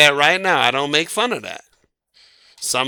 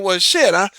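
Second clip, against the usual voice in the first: gossiping women; 6 semitones higher than usual. Retold or imitated. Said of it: "women are sitting in line saying".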